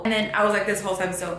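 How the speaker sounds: usual speaking voice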